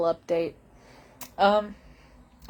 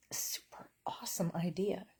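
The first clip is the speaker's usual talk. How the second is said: unvoiced, much softer dry aside; whispered sarcasm; 9 dB quieter than usual. Real, not acted. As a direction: whisper it wryly.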